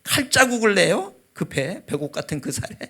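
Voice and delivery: high, loud indignant protest, easing off; threatening prayer. Retold or imitated. Father protesting to God about surgery, indignantly threatening. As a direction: re-enact an outraged challenge to God. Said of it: "하나님을 협박하는 기도".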